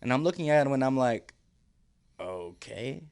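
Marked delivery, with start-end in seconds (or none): 2.2-3.0 low, soft, questioning okay; hesitant agreement. Retold or imitated. Injured man answering medic about signing a waiver, hesitant. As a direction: agree quietly and uncertainly, retelling it. Dazed reply to waiver request.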